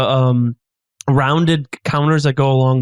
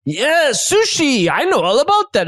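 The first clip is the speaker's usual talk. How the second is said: relishing the topic, in character; knowing chef character; 9 semitones higher than usual. Acted. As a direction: boast playfully in a raised chef voice. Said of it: "and he's saying".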